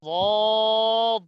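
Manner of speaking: ضاد held very long. Shown as a demonstration. Teacher models a long ضاد for students. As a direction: draw ضاد out fully. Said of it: "letter drill".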